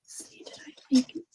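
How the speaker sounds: soft unvoiced whisper. Real, not acted. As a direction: whisper to yourself while working. Whispering while concentrating.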